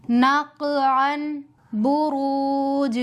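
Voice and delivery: slow, the काफ and जीम bouncing at the stop; slow recitation with a qalqala bounce; qalqala demo. Shown as a demonstration. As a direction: let नक and बुरूज echo at the stop. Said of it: "कलकला किस तरह से करेंगे, ध्यान से सुनिए।".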